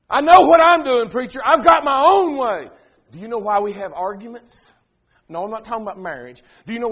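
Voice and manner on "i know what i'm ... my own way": loud, high defiant reply; defiant retort. Retold or imitated. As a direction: snap back with stubborn pride. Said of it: "what they say to me".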